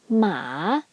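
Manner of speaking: "ma" dipping low, then rising; falling-rising third tone. Shown as a demonstration. Teacher introducing third tone, "ma" dipping then rising. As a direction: draw "ma" down and back up. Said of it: "go down first and then go up".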